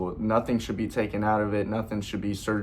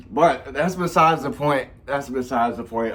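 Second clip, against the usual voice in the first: higher at first, pulling away from real emotion; getting emotional; 4 semitones higher, 5 dB louder than usual. Real, not acted. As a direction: try to move on while getting emotional. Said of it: "I'm getting emotional".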